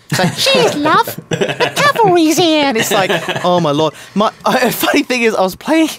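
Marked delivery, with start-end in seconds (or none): 0.3-2.7 high, loud cartoon British chirp; horrifically British accent. Gamer mimicking Tracer to his co-hosts, horrifically British accent. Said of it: "horrifically British".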